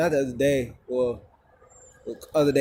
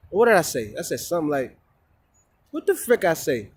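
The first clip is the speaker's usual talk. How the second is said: ordinary delivery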